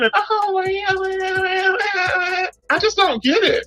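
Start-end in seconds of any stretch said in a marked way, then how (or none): 0.1-2.5 put-on childlike. Mocking a woman who acts childlike, high childish voice. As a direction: mimic a grown woman acting like a little kid. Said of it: "trying to sound like children".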